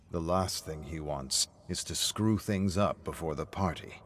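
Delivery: ordinary delivery, nothing marked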